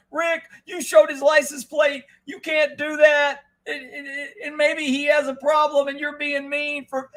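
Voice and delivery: put-on high scolding voice; whiny haters. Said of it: "I had the haters".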